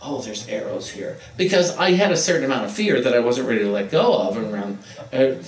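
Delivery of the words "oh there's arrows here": quiet, kind pointer; soft gentle hint. Retold or imitated. Pointing out aisle arrows to a shopper, softly. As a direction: murmur it helpfully.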